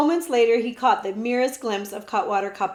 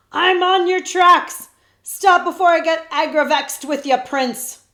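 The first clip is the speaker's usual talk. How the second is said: shout; 7 semitones higher, 10 dB louder than usual. A narrator's reading. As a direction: holler after the fleeing prince. Cutwater shouts during chase.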